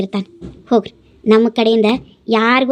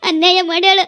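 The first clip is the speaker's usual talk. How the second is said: high-pitched sobbing plea; tearful pleading; 6 semitones higher, 3 dB quieter than usual. Acted. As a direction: beg through tears, voice pitched up. Mother begging forgiveness for daughter. (ಅಳುತ್ತಾ ಅಂಗಲಾಚುವ ಧ್ವನಿ)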